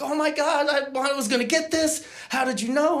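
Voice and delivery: mock delight at the book; thrilled girlfriend.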